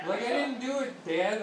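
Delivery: put-on high, loud whiny child; protesting kid. Son protesting innocence to his dad, high whine.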